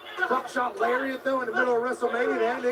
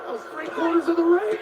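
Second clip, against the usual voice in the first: amazed; 9 semitones higher, 5 dB louder than usual. Real, not acted. Amazed reaction to the Lionsault.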